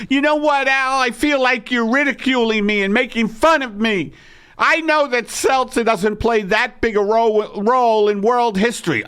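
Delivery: high and huffy, feeling mocked; [name]'s high indignant voice. Wife telling Al he's ridiculing her, high and indignant.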